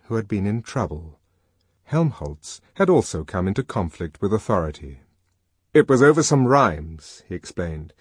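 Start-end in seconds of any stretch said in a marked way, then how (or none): 5.7-7.2 lightly voiced character, a little louder; explaining. A narrator's reading. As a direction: offer an explanation with some lift. Character explains his trouble in dialogue.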